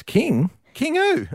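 high, startled "King? King who?"; a confused boy who never heard of a king; baffled kid. Retold or imitated. Migrant boy questions the tram driver, high and baffled. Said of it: "He goes".

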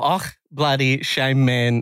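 slow "Ach, bloody shame, man" with a guttural ach in a South African accent; slow South African sigh; South African exclamation. Shown as a demonstration. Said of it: "We're gonna add more".

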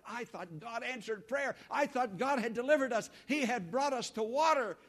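indignant disbelief. Retold or imitated. People voicing disappointment.

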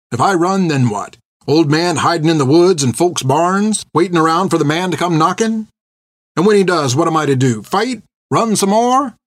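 put-on rising, pleading old man; an old man pushing back, rising. Bartimaeus argues, voice rising.

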